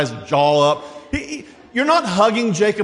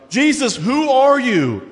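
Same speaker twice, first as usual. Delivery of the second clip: slow, higher pleading question; questioners; 7 semitones higher, slower than usual. Acted. Crowd asks Jesus who he is, slow and higher. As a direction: draw out the question. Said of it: "they asked".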